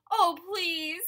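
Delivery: plea rising to a high cry; beg, then burst out high and loud on the last words; building to a desperate shout; loud pleading. Acted. Animal begs elephant not to sneeze.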